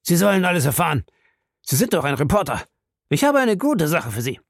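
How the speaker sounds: quick, high, worked-up voice; furious, agitated. A narrator's reading. Krieger promises the reporter a story, still furious. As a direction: blurt it out fast and hotly. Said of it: "den wütenden Krieger".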